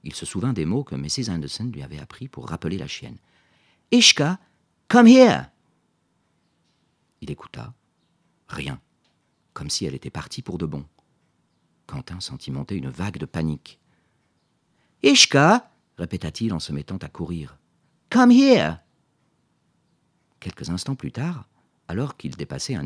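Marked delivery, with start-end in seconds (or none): 3.9-5.5 loud, high call across the field; calling a dog from afar, loud and high. Acted. Boy calls the lost dog. 15.0-15.7 loud, high panicked call; boy shouting for his dog, loud and high. Acted. Boy runs calling the dog. 18.1-18.8 loud, high panicked call; boy shouting for his dog, loud and high. Acted. Boy runs calling the dog.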